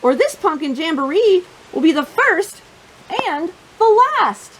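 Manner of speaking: lively picture-book read-aloud voice; bouncy storybook narration for a young audience; reading to children. Acted. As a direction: read it like storytime for little kids.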